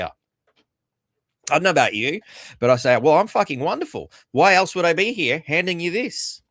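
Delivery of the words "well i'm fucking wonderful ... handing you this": loud, higher, biting sarcasm; sarcastic retort. Retold or imitated. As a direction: fling it back at the pharmacist, dripping sarcasm.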